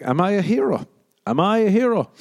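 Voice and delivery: high, rising mock wonder; mock self-amazement. Man who faced down a pest, mock-awed at himself.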